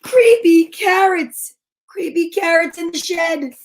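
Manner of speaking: panicked scream. Acted. Jasper yelling to his mom about the shed, panicked. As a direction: shout it in terror. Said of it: "Jasper screamed".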